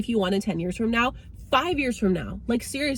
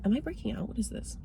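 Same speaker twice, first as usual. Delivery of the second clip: muttered aside; 3 semitones higher, 9 dB quieter, faster than usual. Real, not acted. Aside about her skin breaking out.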